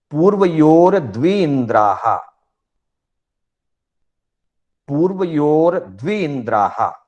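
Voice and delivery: sutra recitation. Shown as a demonstration. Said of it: "छह सूत्रों का उच्चारण आपके सामने करूंगा". (ऊँचे स्वर में सूत्र का उच्चारण)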